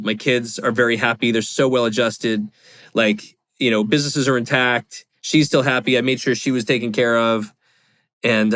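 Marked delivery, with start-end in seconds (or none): none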